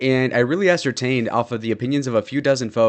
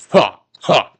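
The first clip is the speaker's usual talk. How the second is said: barking laugh; 3 semitones higher, 8 dB louder than usual. Real, not acted. Laughs at donation.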